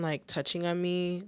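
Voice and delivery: normal talking voice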